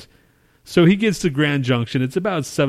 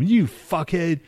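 raised-pitch name-calling; retold pissed-off insult; 5 semitones higher, slower than usual. Retelling his reply to friend coming tomorrow, pissed off. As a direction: re-enact cursing at your friend. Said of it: "Now I'm all pissed off".

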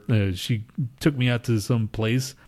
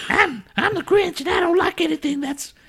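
very high, strange cartoon Grinch voice; high grumpy cartoon villain. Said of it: "Mister Grinch".

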